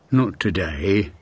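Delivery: low, unhurried tortoise voice. A narrator's reading. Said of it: "said the tortoise".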